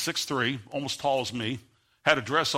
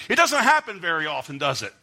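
fiery preaching; 6 semitones higher, 6 dB louder than usual. Acted. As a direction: hammer it home to the congregation at full force. Preacher at full force.

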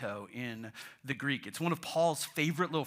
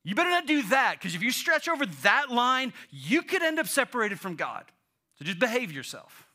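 loud, higher-pitched warning; stern religion; 6 semitones higher, 7 dB louder than usual. Acted. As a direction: scold them as religion personified. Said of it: "Religion wants to say".

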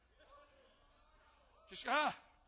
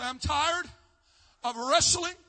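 from plain to loud, higher shouting over music; building cry of weariness; 5 semitones higher, 17 dB louder, slower than usual. Acted. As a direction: let the plea swell to a climax. Voices youth crying out, building.

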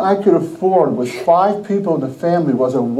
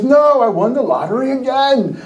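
higher-pitched imitation of a winner insisting; excited insistence; 6 semitones higher than usual. Coworker assuring his doubting friend, excitedly insistent. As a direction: insist on the news, retold.

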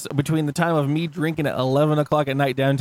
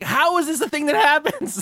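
high, disbelieving question; incredulous; 11 semitones higher than usual. Retold or imitated. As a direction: ask it in shocked disbelief. His disbelief, retold.

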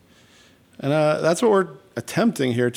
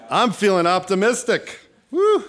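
high, hammed-up enthusiasm with a whoop; peppy optimism; 9 semitones higher than usual. Host reading a card to the audience, playfully upbeat. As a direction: perform optimism, leaping up in pitch.

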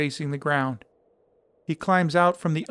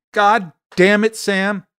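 furious shout, louder and higher; yelling; 6 semitones higher, 9 dB louder, slower than usual. Acted. Travis yelling at ex-husband Sam in her office, furious shout. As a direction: blast it at him in a rage. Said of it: "Travis is yelling".

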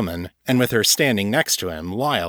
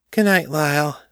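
higher, singsong tipsy farewell; tipsy goodbye; 7 semitones higher than usual. A narrator's reading. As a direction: bid a friend a playful drunken goodnight. Drunk woman's fond goodbye kiss.

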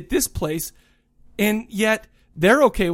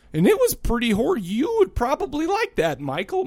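imitating grandma; 6 semitones higher than usual. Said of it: "he says".

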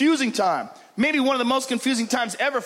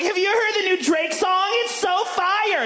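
put-on clueless teen; 8 semitones higher than usual. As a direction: gush like a clueless kid. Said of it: "They're like".

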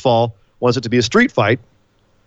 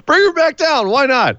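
squeaky, much higher mock plea; much higher repeat; 12 semitones higher than usual. Real, not acted.

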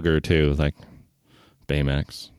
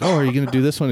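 bright eager question; 9 semitones higher, much faster than usual. Retold or imitated. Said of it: "I was like".

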